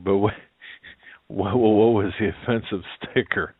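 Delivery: ordinary talk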